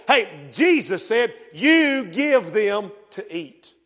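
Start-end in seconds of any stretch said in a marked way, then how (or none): 1.5-3.7 slow, deliberate command. Retold or imitated. Preacher repeating Jesus's command to disciples, slow and deliberate. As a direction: spell out each word slowly. Jesus quoted again, slowed.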